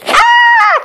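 very high yelp of fright; a shocked little scream; frightened high cry. Acted. Mister Surprise yelping at Mister Greedy in the fridge. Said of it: "cried Mister Surprise".

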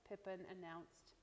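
usual speaking voice

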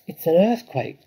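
high, loud cry of alarm. A narrator's reading. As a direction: cry out in alarm as a fox mother. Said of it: "cried Missus Fox".

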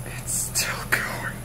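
hushed, breathy-free whisper under the breath; awed whisper. Real, not acted. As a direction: whisper as if not to disturb the dice. Dice still spinning, awed hush.

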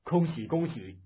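loud, jovial congratulations. A narrator's reading. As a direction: call out congratulations with a laughing lilt. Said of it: "大声笑道".

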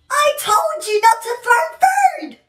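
high, shouted scolding; angry scolding. Acted. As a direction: yell at the child. Mom scolding after food thrown.

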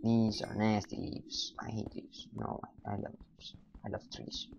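very soft, whispery murmur; hushed aside. Real, not acted. Player mutters to self about leaves and trees, hushed. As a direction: drop to a near whisper.

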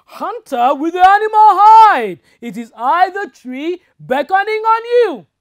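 high, loud mock spirit call; talking tree voice. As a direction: boom like a mysterious tree. Tree voice again.

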